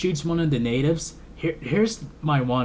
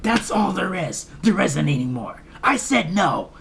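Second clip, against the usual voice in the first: mild mock scolding yell; half-hearted retold yell, firm refusal; imitated yelling; 4 semitones higher than usual. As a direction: act out her telling off a giant ape. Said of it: "she yells at him like".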